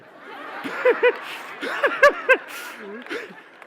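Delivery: big high burst of laughter; real loud laughter, pitched high; loud laughing outburst. Real, not acted. Laughs at his own story.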